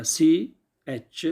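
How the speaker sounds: ची आ का spelled out very slowly letter by letter; teacher spelling C-H slowly. Shown as a demonstration. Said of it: "spelling C H slowly".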